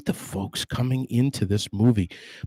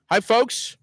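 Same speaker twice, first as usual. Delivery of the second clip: ordinary talk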